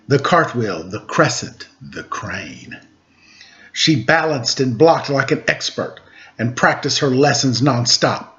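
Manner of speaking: ordinary delivery, nothing marked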